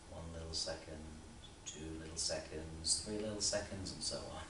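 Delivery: low, hushed, measured count; soft counting. Acted. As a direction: count softly and low, as if to calm yourself. Recites private calming count.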